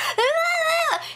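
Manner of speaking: high-pitched fake bawling; mock crying wail. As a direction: imitate someone bursting into tears, hammed up. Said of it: "She starts crying".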